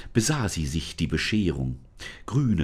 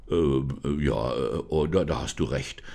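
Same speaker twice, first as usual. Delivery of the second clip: low, hesitant spirit voice, caught out; a lightly voiced spirit stumbling over his words; sheepish spirit; 6 semitones lower than usual. Forest spirit admits Lisa is right, low and flustered. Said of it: "sagte die Stimme".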